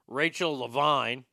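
normal talking voice

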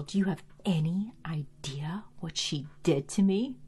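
whispered line, no voice at all; whispered quote. Retold or imitated. Buffy asking Angel, in a whisper. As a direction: breathe it out as a hushed whisper. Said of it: "Buffy says to Angel".

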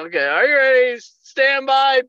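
high, loud imitated range commands; mock start call, projected high; range officer call. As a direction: call the shooter to the line.

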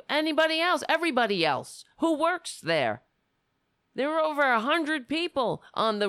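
loud, heated tirade; angry rant. Real, not acted. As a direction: rail against them. Angry rant about the president.

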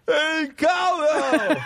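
high, slow, loud shout; fan shouting cowbell. Retold or imitated. Walken retelling fans shouting at him, loud high yell. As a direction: yell it like a fan across the street. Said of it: "Every fucking where I go".

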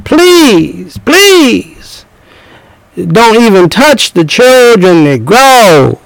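high soaring Please, please, slowing into a wail; [name] please. Retold or imitated. Preacher mimics [name], high and drawn out. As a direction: plead it like the Godfather of Soul, up high. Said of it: "like [name]".

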